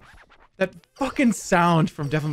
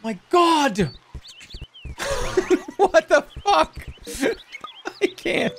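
shocked outburst; slower than usual. Real, not acted. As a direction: blurt it out in shock. Startled outburst.

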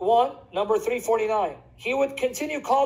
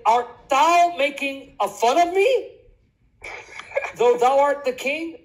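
slow, high, theatrical affront; hammed-up protest, pitched up; acted indignation; 4 semitones higher, 3 dB louder, slower than usual. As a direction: draw out the offended question. Acting the upset man again.

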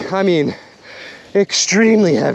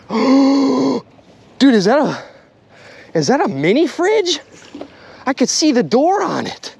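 can't believe what just surfaced; gasping at the find; astonished; 8 semitones higher than usual. Real, not acted. Spots a fridge in the water.

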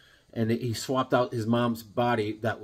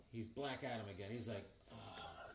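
whispered awe; 3 semitones lower, 24 dB quieter than usual. Retold or imitated.

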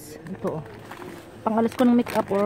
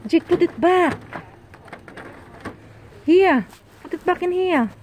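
squeaky praising a dog; 9 semitones higher, 5 dB louder than usual. Real, not acted. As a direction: sternly coax him to put it back. Scolding child to return item.

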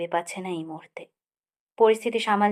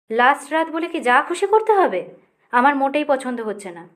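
sharp snap, higher and louder; snapping; 5 semitones higher, 6 dB louder than usual. A narrator's reading. Rohini snapping at Akash over the late night, angry snap. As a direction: bite the words out in irritation. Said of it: "প্রায় খেঁকিয়ে উঠলাম".

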